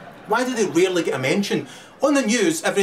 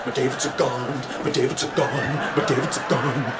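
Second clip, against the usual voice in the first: mock grieving mother; 6 semitones lower than usual. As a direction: lament like a bereaved mum, lower. Mourning mother voiced.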